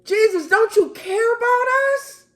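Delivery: frantic shout, voice cracking near tears; terrified yelling. Acted. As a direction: scream it like you're about to drown. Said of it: "And they're yelling".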